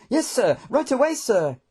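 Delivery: high, keen reply; great enthusiasm. A narrator's reading. Hectorus answering the general's order, very enthusiastic. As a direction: jump to it eagerly. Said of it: "Hectorus replied with great enthusiasm".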